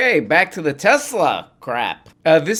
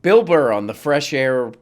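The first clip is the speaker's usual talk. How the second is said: normal talking voice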